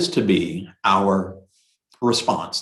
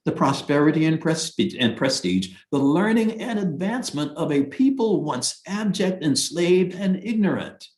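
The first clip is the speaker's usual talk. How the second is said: raised, declaiming scripture reading; formal reading; 5 semitones higher than usual. Acted. Teacher rereading a sacred passage to the class, declaiming. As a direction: read the passage out with gravity.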